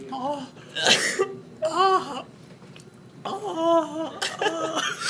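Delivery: repeated high drawn-out "oh"; pained moaning. Acted. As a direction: moan piteously, high in the voice. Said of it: "and he's just".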